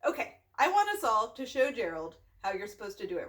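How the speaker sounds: plain reading voice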